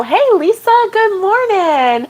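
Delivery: bright greeting. Real, not acted. Greeting a live chat viewer.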